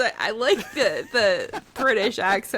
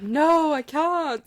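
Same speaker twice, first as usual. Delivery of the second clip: higher and louder, a mock British accent; imitating a British accent; 6 semitones higher, 3 dB louder, much slower than usual. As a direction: tease him by putting on his British accent.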